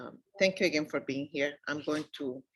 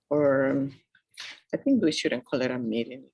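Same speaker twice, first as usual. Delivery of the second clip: ordinary talk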